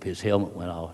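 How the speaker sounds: ordinary talk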